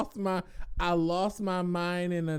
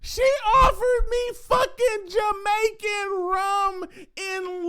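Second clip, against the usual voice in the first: retelling the rum refund, shouting in outrage; outraged shout; 12 semitones higher, slower than usual. Retold or imitated. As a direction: shout it like you still can't believe it.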